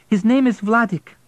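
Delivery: wife correcting the station master; woman interjecting. Acted. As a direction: cut in sharply. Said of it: "the woman interjected".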